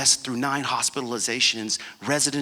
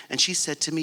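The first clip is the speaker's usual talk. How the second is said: normal talking voice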